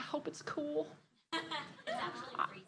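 breathy whispered joke; whispered aside. Real, not acted. Aside about cold room.

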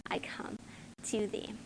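breathy whisper at the close of the verse. Acted. Pastor ending the hymn verse to congregation, whispered. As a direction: let the words fade into a whisper. Final hymn line, fading out.

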